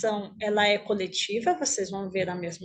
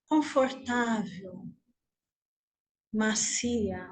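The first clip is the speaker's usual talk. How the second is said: guided meditation; 3 dB quieter than usual. Acted. Teacher leading the group lying on imagined grass, guided meditation. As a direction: guide them gently inward, unhurried, leaving room to breathe.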